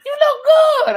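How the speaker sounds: very high, loud imitation of astonishment; amazed compliment. Retelling the driver's compliment as he drove, amazed. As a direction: squeal it like an astonished admirer.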